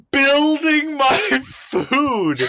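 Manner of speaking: slow, high, mocking drawl. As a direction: stretch each word in disbelief. Mocking the quote again.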